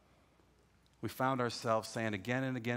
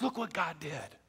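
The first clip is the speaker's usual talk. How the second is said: Kenny amazed; 6 semitones higher than usual. Retold or imitated. Cousin Kenny telling Sal the prayer worked, high amazed voice. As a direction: marvel at answered prayer. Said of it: "He goes".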